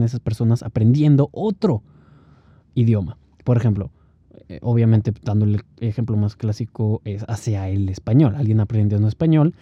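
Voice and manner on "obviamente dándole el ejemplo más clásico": usual speaking voice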